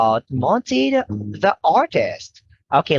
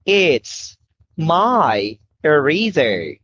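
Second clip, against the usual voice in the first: slow model sentence; slower than usual. Shown as a demonstration. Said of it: "Okay, now you say".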